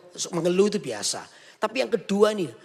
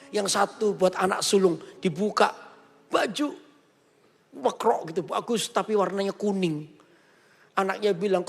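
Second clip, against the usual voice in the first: usual speaking voice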